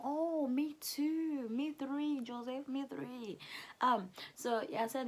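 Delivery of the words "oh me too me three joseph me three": slow, high, playful eager reply. Retold or imitated. Quoting his eager reply, slow and playful. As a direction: stretch it out, delighted.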